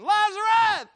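huge, high, drawn-out shout; loud cry. Acted. Jesus calls the dead man from the tomb, loud cry. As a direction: call out the name at full volume. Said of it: "he cried out with a loud voice".

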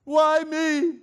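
very high, very loud wailing; hammed-up cry of despair to God; anguished cry. Preacher mocks the complaining believer with a very high wailing cry. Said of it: "we love to say these wonderful words".

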